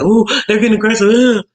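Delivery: high, loud pearl-clutching; hammed-up scandalized squeal; mock alarm. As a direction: imitate someone overreacting in fright. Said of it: "Somebody acts like the".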